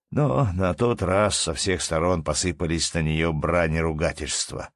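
usual speaking voice

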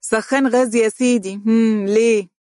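puzzled sneer, rising; surprised and mocking. A narrator's reading. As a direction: scoff at the question. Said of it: "بدهشة وقال متهكما".

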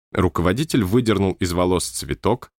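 plain reading voice

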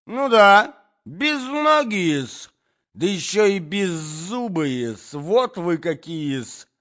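high, loud, stretched insults; screeching quarrel voice; spiteful Natalya. A narrator's reading. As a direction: pile on the abuse slowly and shrilly.

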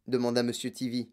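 ordinary delivery

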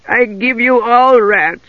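high, slow pleading creature; drawn-out, high little wretch; sniveling voice. Acted. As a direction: snivel out a humble offer. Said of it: "said the sniveling voice".

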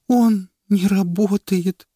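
high, slow, broken tearful voice; helpless. Acted. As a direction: say it utterly defeated. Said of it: "бессильно сказала супруга". (жена бессильно, сквозь слёзы)

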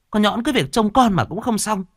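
high-pitched nagging wife; acted wifely telling-off, pitched well up; exasperated scolding. A narrator's reading. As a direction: scold him in a high, fed-up voice. Wife scolds husband over crying child.

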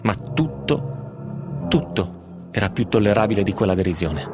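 tense, measured reading of a horror tale; story narration. A narrator's reading. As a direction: read it like a confession growing in dread. Reads Poe tale on radio. (lettura narrativa tesa)

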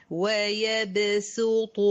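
"ويبسط" all thin and light except a heavy ط; light seen, heavy final ta. Shown as a demonstration. Teacher modelling the correct reading, "ويبسط" light until the heavy ط. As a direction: slowly chant "ويبسط" with light s and emphatic ط. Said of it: "الكلمة دي كلها مرققة ما عدا الطه".

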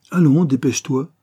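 impatient urging. A narrator's reading. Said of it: "lui dit Laurent en lui bourrant".

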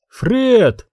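high-pitched call from a window; narrated woman's summons, high. A narrator's reading. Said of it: "позвал из окна женский голос".

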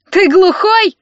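high, loud angry snap; hot-tempered shouted question; flaring up. A narrator's reading. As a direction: lose your temper at a rival. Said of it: "вспылил Костя".

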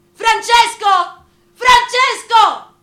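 long, high, desperate scream of a name; Anna Magnani's cry. Retold or imitated. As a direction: wail the name across the street like a mother losing him. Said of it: "Quel grido lo conosciamo tutti".

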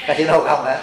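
usual speaking voice

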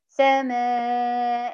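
سماء held out very long on a level tone; stop with long alif. Shown as a demonstration. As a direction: stretch the final vowel of سماء as a natural madd. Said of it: "هتبقى إيه؟".

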